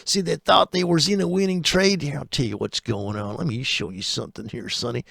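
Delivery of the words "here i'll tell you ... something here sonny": put-on softer patronising old-timer voice; condescending old-timer. Trader mocking a patronising old-timer, softer. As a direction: talk down to the kid.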